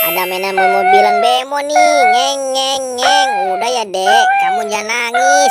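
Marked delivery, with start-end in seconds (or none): 2.1-3.3 drawn-out "ngeng" motor imitation; toy car noise. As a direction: rev the toy car to amuse a child. (tiruan bunyi mobil mainan)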